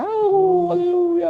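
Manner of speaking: long, high, drawn-out cry; mock worship shout. Pastor mimicking worshippers seeing the fake glory cloud, shouting. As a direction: imitate a congregation crying out in praise. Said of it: "sigawan sila".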